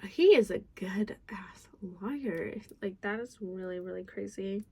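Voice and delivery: hushed aside. Real, not acted. Aside about the liar.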